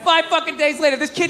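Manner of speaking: ordinary talk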